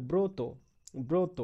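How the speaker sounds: ব্রত said as "broto", with an o after ব্র; pronunciation demo. Shown as a demonstration. Teacher models ব্রত for the class, with the o sound. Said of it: "বয়র ফলা ওকার হয়ে যাচ্ছে".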